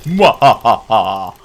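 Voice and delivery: slow villain cackle; mad scientist. Acted. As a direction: gloat with a slow evil laugh. Evil laugh after killing enemies.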